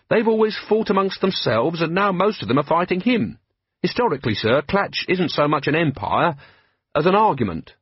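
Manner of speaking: put-on eager young captain, respectful; lightly voiced earnest young man; Carrot, earnest young officer. Carrot speaking.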